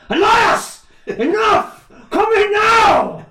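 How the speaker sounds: high, loud, drawn-out parent yell; full angry mum shout; furious shout. Shown as a demonstration. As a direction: bellow at a disobedient toddler. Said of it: "I have to go like".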